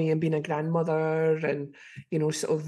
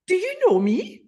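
puzzled question; 11 semitones higher, 5 dB louder than usual. Retold or imitated. Stranger questions her on bus.

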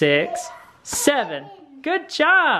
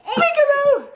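put-on high, sing-song peekaboo for the toddler; playful peekaboo; 12 semitones higher than usual. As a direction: call peekaboo to make the baby laugh. Peekaboo game with kids.

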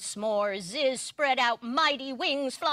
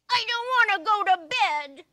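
put-on high, loud, fast whiny child; a kid refusing bedtime; child protesting; 8 semitones higher, 7 dB louder, faster than usual. Child arguing with parent at bedtime, whiny protest. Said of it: "said the child".

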